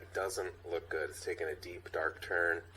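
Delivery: low, soft ominous teaser; lightly voiced TV teaser; ominous voice-over. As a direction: tease the finale darkly. Dramatic recap voice-over.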